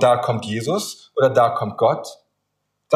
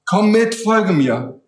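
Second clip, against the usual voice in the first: higher, insistent call to follow; urgent masked rescuer; 5 semitones higher, slower than usual. Acted. Masked stranger waking a sleeper to rescue him, urgent. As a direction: play the rescuer pressing a sleeper to come along. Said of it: "Er nuschelt und sagt".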